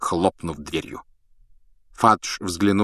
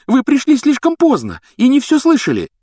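very high, loud retort; heated objection; 8 dB louder than usual. A narrator's reading. Hermione telling the professor he missed it, heated. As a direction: argue back hotly. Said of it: "горячо возразила Гермиона".

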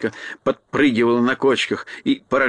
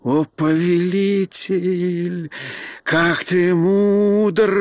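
high, slow, drawn-out rapture; simple-hearted creature gushing at its master; delighted cry; 5 semitones higher, much slower than usual. A narrator's reading. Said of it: "в восторге завопила простодушная Шкура". (восторженно, высоко и протяжно)

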